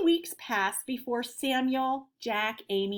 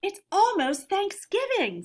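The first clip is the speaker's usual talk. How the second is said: put-on higher and louder, excited child; excited boy; 10 semitones higher, 5 dB louder than usual. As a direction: bubble over with excitement. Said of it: "said Ethan excitedly".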